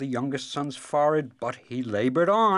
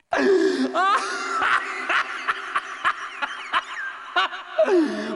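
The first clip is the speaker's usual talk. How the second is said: high, crying laugh near sobs; wailing laugh; 3 dB louder than usual. Acted. Dragon laughs at the son's offended dignity, wailing laugh. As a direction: laugh until it turns to a wail. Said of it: "Wailed the dragon, almost sobbing".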